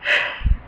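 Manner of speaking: unvoiced breathy sigh after a mistake; real resetting sigh before a retake. Real, not acted. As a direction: let out a breath after fluffing a line. Sigh after fluffing the line.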